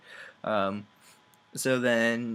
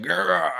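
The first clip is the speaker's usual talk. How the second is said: higher, louder mock roar of rage; hammed-up roar; 7 semitones higher, 9 dB louder than usual. Man bashing his head into the wall, hammed-up roar. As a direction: let out a cartoon roar.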